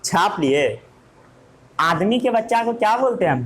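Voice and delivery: normal talking voice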